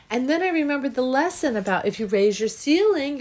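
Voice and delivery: ordinary talk, nothing marked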